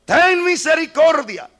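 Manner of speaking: loud and high, slowing at the end; fervent plea. Acted. Believers crying to the Lord for mercy, loud and high. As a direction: cry out for mercy. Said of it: "y decir".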